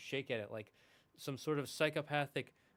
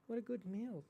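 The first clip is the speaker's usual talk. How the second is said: cooing at cat; 8 semitones higher than usual. Real, not acted.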